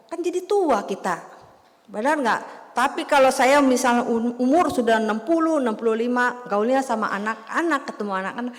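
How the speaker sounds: ordinary delivery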